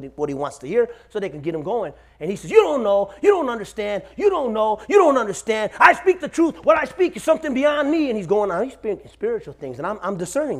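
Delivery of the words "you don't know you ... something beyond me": high, loud insistent ranting; imitated rant. Possessed man insists to those around him, agitated ranting. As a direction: build from raised insistence to a high near-shout.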